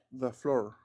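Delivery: "the floor" with "the" as "da"; "the" before consonant. Shown as a demonstration. Teacher gives an example, "the floor" said "da floor". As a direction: say "the floor" as "da floor", tapped r. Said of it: "Como the".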